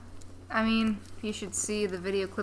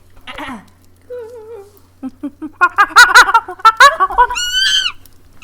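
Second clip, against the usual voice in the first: loud screeching monkey hoots; monkey chatter; 14 semitones higher, 19 dB louder than usual. Pretend. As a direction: shriek like a wild circus monkey. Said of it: "You want me to do my monkey impression?".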